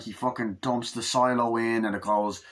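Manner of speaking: usual speaking voice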